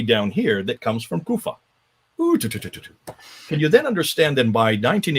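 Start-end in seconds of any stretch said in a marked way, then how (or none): 2.2-3.1 mock tutting. Real, not acted.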